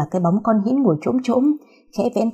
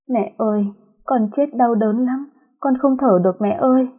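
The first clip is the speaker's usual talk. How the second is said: put-on ghost child voice; 4 semitones higher than usual. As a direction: plead like a dead child in pain. Hồn ma con gái gọi mẹ. (giọng hồn ma trẻ con rên rỉ)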